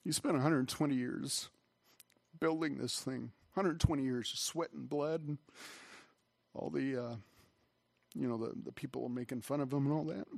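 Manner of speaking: choked up, halting with long pauses; getting emotional. Real, not acted. Preacher moved by Noah's hundred twenty years, getting emotional. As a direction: hold back real emotion between phrases. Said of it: "I don't know why I'm getting emotional".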